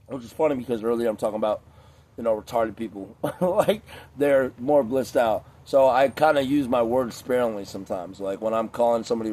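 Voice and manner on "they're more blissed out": normal talking voice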